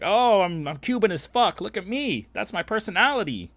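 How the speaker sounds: high, loud mocking voice of a proud Cuban guy; mocking a show-off. Mocking guys who brag about being Cuban, high and loud. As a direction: sneer it out high and loud like a poser bragging. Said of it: "I don't walk around like".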